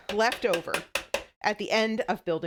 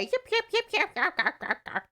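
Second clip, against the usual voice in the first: high yapping dog imitation, pitched way up; yippy little dog; 10 semitones higher than usual. Imitating the woman's yippy poodle on the plane. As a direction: yap like a tiny poodle. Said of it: "yapping away".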